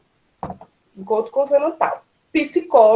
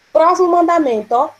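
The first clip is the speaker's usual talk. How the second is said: next commandment call; 6 semitones higher, 5 dB louder than usual. Real, not acted.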